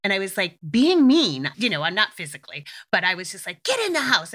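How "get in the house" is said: curt, high-pitched order to the dog; bitchy tone. Retold or imitated. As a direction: snap the command like you've lost patience. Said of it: "being bitchy in my tone".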